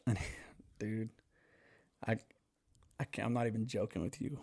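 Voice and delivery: ordinary delivery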